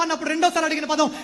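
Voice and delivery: normal talking voice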